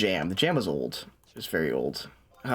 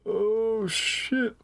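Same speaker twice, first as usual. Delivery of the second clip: being chased; 8 semitones higher than usual. Real, not acted.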